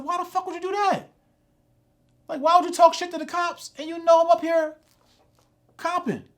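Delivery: high-pitched, exasperated disbelief; incredulous scolding. Retold or imitated. Passenger retelling how he questioned his driver, incredulous scolding.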